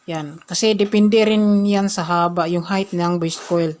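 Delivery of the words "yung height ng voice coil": ordinary delivery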